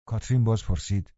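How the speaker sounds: ordinary delivery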